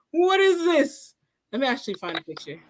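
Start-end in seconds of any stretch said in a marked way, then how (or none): 0.1-1.2 high, drawn-out astonishment; mock disbelief. Fan retelling surprise selfie, high and incredulous. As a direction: stretch it out in amazed disbelief.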